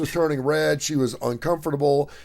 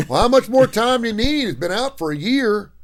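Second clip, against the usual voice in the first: higher, louder, incredulous question; 10 semitones higher, 6 dB louder than usual. Retold or imitated. A worker asks the girl how much more time, loud and baffled. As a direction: push the point, openly baffled. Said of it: "worker presses again".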